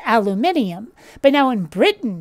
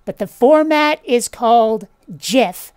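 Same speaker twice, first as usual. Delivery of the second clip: ordinary talk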